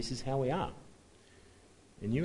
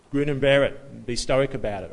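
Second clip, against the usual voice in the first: loud, firm mock pep talk; mock tough advice; 8 dB louder than usual. Preacher parodying stoic advice to the worried, loud and emphatic. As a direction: imitate someone briskly telling you to toughen up.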